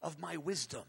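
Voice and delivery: a hint of a calm divine answer; voice of the Lord. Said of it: "the Lord might say something like".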